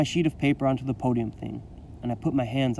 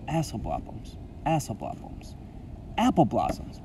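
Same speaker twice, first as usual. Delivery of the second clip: thinking to oneself; 5 dB quieter than usual. A narrator's reading. Boy replaying his slip to himself, hushed. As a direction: say it softly to yourself. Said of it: "I think to myself".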